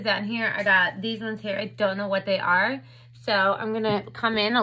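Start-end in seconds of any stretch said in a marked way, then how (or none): none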